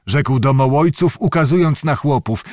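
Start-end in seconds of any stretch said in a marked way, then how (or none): none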